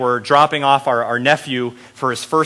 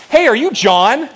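put-on young counselor's eager call; 9 semitones higher, 4 dB louder than usual. Counselor peering into the car calls out, eager high question. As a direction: voice the counselor higher, bright and calling.

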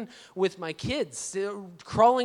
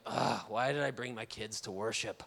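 low, soft grumble; retold weary regret with a groaned start; mildly sulky, lower and quieter; awful attitude; 9 semitones lower, 7 dB quieter than usual. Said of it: "my attitude is just kinda awful".

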